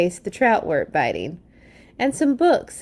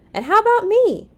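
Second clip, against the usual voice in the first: very high, loud little cub voice; little Sister Bear; 14 semitones higher, 5 dB louder than usual. Acted. Sister asking Papa for a turn, very high. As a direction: squeak it out like an eager small cub.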